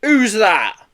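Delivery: loud, high demand; shouted challenge. A narrator's reading. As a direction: call out sharply to an intruder. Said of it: "shouted one of the men".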